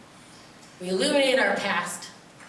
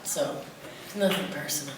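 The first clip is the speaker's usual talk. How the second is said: normal talking voice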